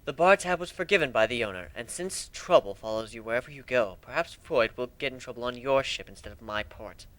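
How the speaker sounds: ordinary delivery, nothing marked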